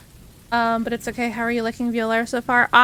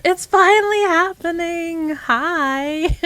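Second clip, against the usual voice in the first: excited; 5 semitones higher, 5 dB louder, slower than usual. Real, not acted. Raid arrives.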